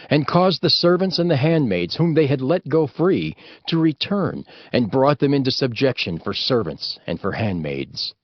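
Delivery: ordinary delivery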